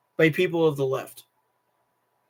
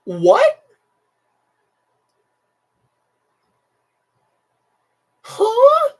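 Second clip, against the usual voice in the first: high, loud shocked "What? Huh?"; a sharp jump up in pitch, loud and stunned; retold shock, pitched way up; stunned disbelief; 8 dB louder than usual. Reacting to classmate saying good.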